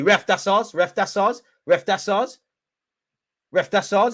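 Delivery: high, rising appeal to the ref; insistent appeal. Real, not acted. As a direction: call out urgently, pitch lifting at the end. Appealing to the referee.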